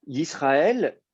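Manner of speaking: Yisraël drawn out slowly, syllable by syllable; slow spelled-out Yisraël. Shown as a demonstration. Teacher slowly sounds out Yisraël for the student. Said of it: "Alors, Yisraël".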